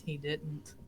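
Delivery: low mutter under the breath. Acted. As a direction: drop it low and soft, as if to yourself. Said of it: "she just kinda mutters".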